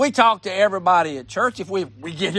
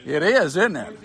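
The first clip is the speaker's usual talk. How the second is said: normal talking voice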